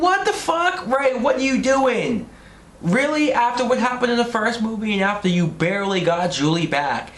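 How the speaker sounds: high-pitched, incredulous scolding; exasperated outrage. Acted. Berating the film character for his behaviour.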